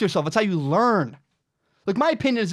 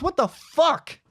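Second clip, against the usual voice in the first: exasperated disbelief; 9 semitones higher, slower than usual. Real, not acted. Exasperated at ending friendships over pets.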